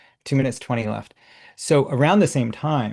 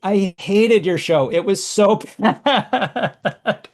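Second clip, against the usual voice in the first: higher, louder and slow, breaking into a laugh; horrified confession; 6 semitones higher, 3 dB louder, much slower than usual. Retold or imitated. Telling the performer she hated the show, laughing. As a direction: blurt it out, half appalled and half laughing. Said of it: "in horror".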